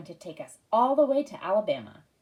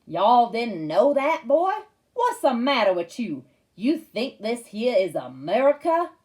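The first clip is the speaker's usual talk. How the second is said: loud, slow mocking hillbilly Southern accent; hammed-up Southern drawl taunting; 2 semitones higher, 6 dB louder, slower than usual. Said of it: "say more Southern-style stuff".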